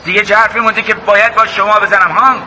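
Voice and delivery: high-pitched, aggrieved protest; upset outburst. Acted. Son protesting to his mother over losing Nastaran, upset and high-pitched.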